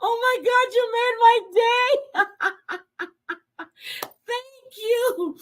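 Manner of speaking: choked up with gratitude; happy tears. Real, not acted. Streamer thanks Island Girl for her gift, crying happy tears.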